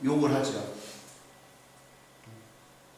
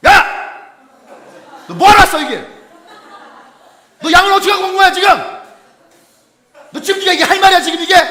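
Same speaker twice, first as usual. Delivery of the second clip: furious shout, much higher and louder; scolding yell at a kid who tagged along; angry older brother yelling; 9 semitones higher, 12 dB louder, faster than usual. Retold or imitated. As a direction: bark at the little brother like an enraged big brother. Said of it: "그 우리말로 하면 뭐예요?".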